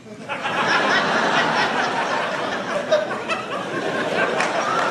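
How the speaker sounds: high drawn-out laugh; long high laugh. Real, not acted. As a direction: let the laugh ride up.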